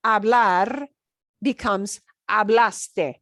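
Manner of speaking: hablaste drawn out slowly and clearly; slow demo. Shown as a demonstration. Teacher demonstrating the verb form, slowly. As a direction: spell out hablaste slowly by sound. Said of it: "here's what it will sound like".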